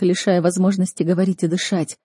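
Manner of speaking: ordinary delivery, nothing marked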